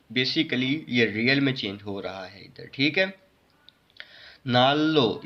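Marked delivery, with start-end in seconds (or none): 4.5-5.2 नाल लो joined on one held L; single L sound. Shown as a demonstration. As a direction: run नाल लो together on ल. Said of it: "इसको ऐसे पढ़ेंगे". (नाल लो, एक ही लाम से)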